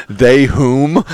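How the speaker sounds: high, loud mock haughty question; mock posh query. Host mocks cohost's odd grammar in a high posh voice.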